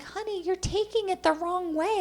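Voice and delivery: high-pitched imitation of a mother; placating mom. Mother tells daughter she misunderstood, high and placating.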